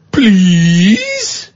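high, drawn-out mocking "Please?"; mock snippy please. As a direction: sarcastically demand manners with a rising "Please?".

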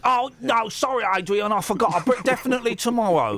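high-pitched mock apologetic voice; imagined debtor's excuse. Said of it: "And you'll see him outside".

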